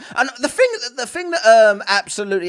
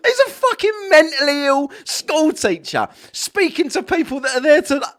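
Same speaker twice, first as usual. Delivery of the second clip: laughing; 6 semitones higher, 4 dB louder than usual. Real, not acted. Laughing while ranting at clip.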